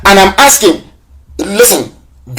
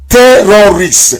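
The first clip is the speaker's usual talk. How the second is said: ordinary talk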